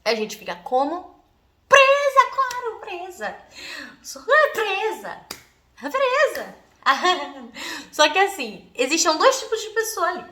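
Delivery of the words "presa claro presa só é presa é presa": dramatic despair. Retold or imitated. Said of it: "canceriana dramática".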